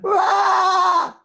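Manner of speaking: high, loud rasping drive ah; drive scream. Shown as a demonstration. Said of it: "vou te ensinar o drive aqui".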